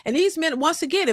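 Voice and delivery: ordinary talk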